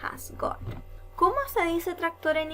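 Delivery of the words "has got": "Has got" whispered softly as an echo; whispered repeat. Shown as a demonstration. Teacher echoes the phrase for the class in a whisper. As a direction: breathe "Has got" in a hush. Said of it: "cómo digo tiene".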